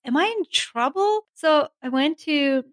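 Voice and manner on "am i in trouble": high, anxious retold panic; a sudden jolt of worry, voiced much higher; worried. To herself after the dean's list letter, mock worried. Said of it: "I was so worried".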